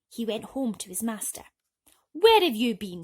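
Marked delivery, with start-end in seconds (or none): 2.1-3.0 much louder angry snap; snapping. Acted. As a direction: bark it at the cat. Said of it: "snapped Jack".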